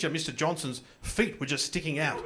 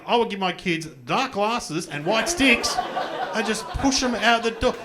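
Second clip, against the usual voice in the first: usual speaking voice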